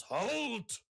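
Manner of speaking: booming ogre shout, high and loud; magically amplified voice. Acted. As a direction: boom it out like a giant. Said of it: "a loud voice boom at you from ahead".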